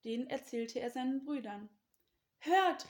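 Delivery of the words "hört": high, loud call for attention; eager, raised call. A narrator's reading. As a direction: call out to gather listeners. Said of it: "rief er".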